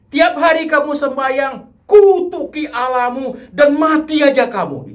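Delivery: high, loud retold scolding from Job's wife; imitating a harsh wife scolding her husband; cruel scolding. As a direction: lash out cruelly at a sick husband. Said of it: "Kejam itu kalimat".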